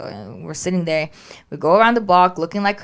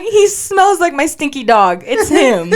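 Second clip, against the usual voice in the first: higher, louder, slow, through laughter; laughing; 8 semitones higher, 7 dB louder, slower than usual. Retold or imitated. Owner laughs telling husband the stinky dog is theirs, laughing. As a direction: insist while laughing.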